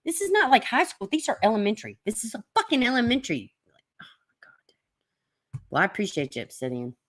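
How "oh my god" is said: breathless slow whisper; a hushed, drawn-out oh my God. Real, not acted. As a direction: whisper it to yourself in disbelief. Exasperated aside.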